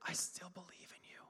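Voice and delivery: soft unvoiced whisper of God; hushed tender whisper. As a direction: breathe it into their ear. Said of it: "He's whispering in your ear".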